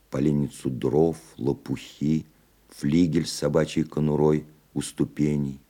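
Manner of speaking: ordinary delivery, nothing marked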